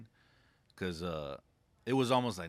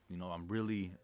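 ordinary talk, nothing marked

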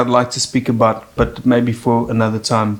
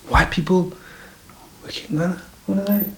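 hushed mock amazement; 4 semitones higher, 8 dB quieter than usual. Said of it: "and I said".

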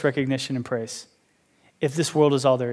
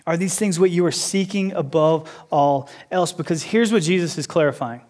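ordinary talk, nothing marked

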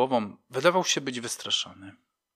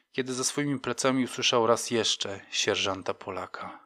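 ordinary delivery, nothing marked

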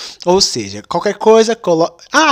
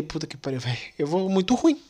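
muttered despair; 11 dB quieter than usual. Retold or imitated. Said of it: "eu fiquei".

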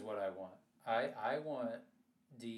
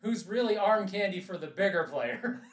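smug schemer; 8 semitones higher, 10 dB louder than usual. Pretend. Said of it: "She's like".

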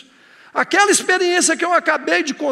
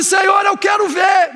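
blind man's cry; 7 semitones higher, 6 dB louder than usual. Acted. Blind Bartimeu cries to Jesus.

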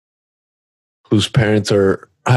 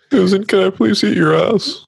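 much higher put-on begging; high pleading voice; 11 semitones higher than usual. Friend goofing on a plea to Susan, high mock begging. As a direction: plead in a silly high-pitched voice.

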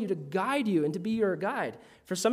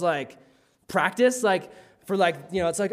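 ordinary talk, nothing marked

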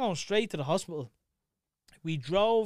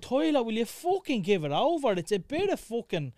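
higher, exasperated retold scolding; annoyed parent; 6 semitones higher, 4 dB louder than usual. Parent to crying Tyler over a bit of chocolate, annoyed. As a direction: replay an irritated telling-off, pitch climbing. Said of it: "I was getting annoyed now".

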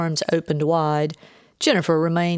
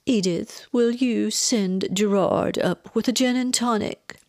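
higher, theatrically languid request; feigned exhaustion; 6 semitones higher than usual. A narrator's reading. Mother asking for a drink, feigning exhaustion. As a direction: play up being worn out. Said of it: "Feigning exhaustion".

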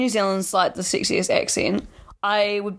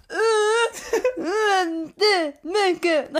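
high, laughing groans of disgust; mock cringing noises; 10 semitones higher, much slower than usual. Podcaster showing how American accents affect her, high mock-pained noises. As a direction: whine squeamishly through giggles. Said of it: "this is bad for every time they talk".

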